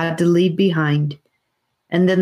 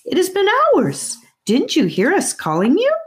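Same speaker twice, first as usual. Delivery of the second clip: worried aunt; 12 semitones higher than usual. A narrator's reading. Aunt scolding lost children, high and worried. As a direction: scold out of worry. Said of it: "hot with worry".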